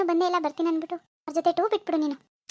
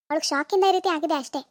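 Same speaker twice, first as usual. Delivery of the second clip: usual speaking voice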